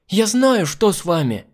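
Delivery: put-on loud, high excited girl's cry. A narrator's reading. Girl announcing her diagnosis to the hostess, crying out. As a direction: burst out with the discovery. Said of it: "вскрикнула Миранда".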